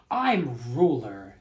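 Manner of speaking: loud, slow pompous king; proud turtle king. Acted. Yertle boasting about his kingdom, pompous loud king. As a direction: puff up like a boastful ruler. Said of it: "said Yertle".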